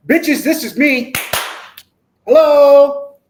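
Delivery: high, loud, slow declaration; acted out-and-proud holler, drawn out; bold proclamation. Telling the world who you are, brash and proud.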